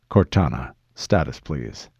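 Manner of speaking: deep, measured request for status; low commander. Acted. Master Chief asks his AI for status, low and controlled. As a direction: drop the voice low, keep it controlled.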